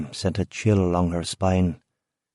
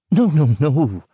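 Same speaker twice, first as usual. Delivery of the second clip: nervous laugh; 6 semitones higher, 3 dB louder, slower than usual. A narrator's reading. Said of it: "she laughed nervously".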